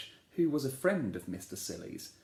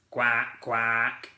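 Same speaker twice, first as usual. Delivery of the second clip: louder duck quacks; a worm doing a duck's quack, louder; mock duck quack; 6 dB louder than usual.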